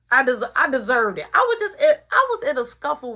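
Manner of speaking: loud outburst jumping up high; insist, climbing into a high shout; emphatic insistence. Real, not acted. Insisting that he deserved it.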